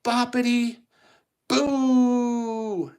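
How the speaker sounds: high, loud magic words; climbing fairy godmother incantation; spell casting. Acted. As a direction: ring out each word, holding the last. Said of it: "And she goes".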